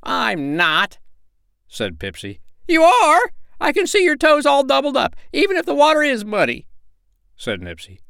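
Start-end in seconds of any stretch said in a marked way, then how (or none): 0.0-1.0 higher little bird retort; stung sandpiper, pitched up; indignant denial. A narrator's reading. Pipsy denies the accusation. 2.7-6.7 very high, loud squeaky insistence; indignant little bird accusing; quarrelling sandpiper. A narrator's reading.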